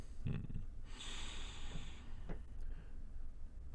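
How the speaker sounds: soft, breath-only chuckle; long, hushed villain's chuckle; low chuckle. Acted. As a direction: chuckle quietly to yourself. Villain amused by the question.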